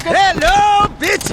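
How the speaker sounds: high, loud, gleeful yell; loud taunting greeting. Acted. Gang leader bursting into the rival plant, loud gleeful yell. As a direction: burst in crowing at the enemy.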